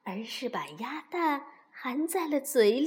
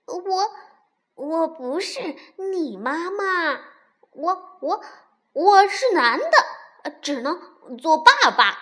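higher, halting, startled protest; flustered stammering; 3 semitones higher, 7 dB louder than usual. A narrator's reading. Startled fox tells duckling he's male, flustered stammering. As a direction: stammer it out, bursting loud on key words. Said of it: "结结巴巴地说".

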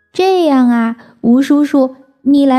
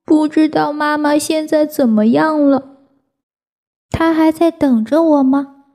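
tearful; 7 semitones higher than usual. Acted. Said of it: "抽抽搭搭哭着说".

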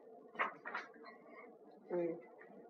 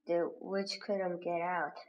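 ordinary delivery, nothing marked

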